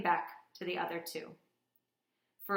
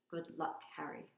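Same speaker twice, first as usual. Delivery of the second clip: murmured; 2 semitones lower, 8 dB quieter than usual. Acted. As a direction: murmur it under your breath. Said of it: "he murmured".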